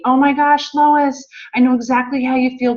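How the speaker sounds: high, loud burst of enthusiasm; excited gush. Retold or imitated. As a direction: gush like a thrilled friend reaching out. Voicing Ranelli's excited reply.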